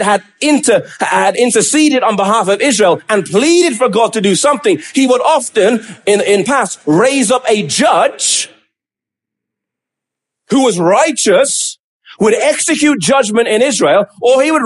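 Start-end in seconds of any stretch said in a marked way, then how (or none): none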